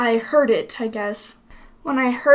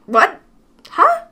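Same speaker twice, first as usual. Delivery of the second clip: baffled, pitch leaping up; short questioning yelps of confusion; Dark Link; 7 semitones higher, 4 dB louder than usual. Acted. Villain thrown by the hero's retort, baffled. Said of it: "That's kind of Dark Link's reaction".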